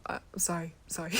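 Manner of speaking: ordinary talk, nothing marked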